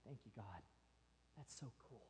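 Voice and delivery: barely audible, low thanks; so quiet. Acted. Believer acknowledging an answered prayer, extremely quiet. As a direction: murmur it almost to nothing. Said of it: "we're often so quiet".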